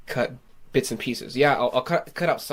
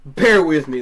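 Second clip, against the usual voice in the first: loud; 3 semitones higher, 13 dB louder than usual. Real, not acted. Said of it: "I sound like a fucking lunatic".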